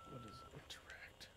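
whispered question; breathy whisper. Real, not acted.

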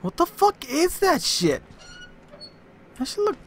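high startled exclamation. Real, not acted. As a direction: jolt of surprise, voice jumping up. Startled by a strange object.

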